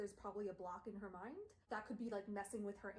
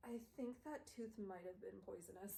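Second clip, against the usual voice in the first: woozy mumble; 6 dB quieter than usual. Retold or imitated. Woozy retold quote after bite.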